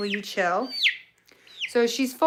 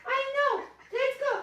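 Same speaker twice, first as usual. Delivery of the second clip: much higher sing-song coaxing; 7 dB quieter than usual. Real, not acted. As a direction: coax the bird sweetly, way up high. Coaxing the bird back in.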